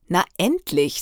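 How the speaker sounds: usual speaking voice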